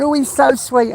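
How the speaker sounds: high, sing-song mock gushing; mocking camp voice. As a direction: lightly imitate a flirty admirer. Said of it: "And I was going".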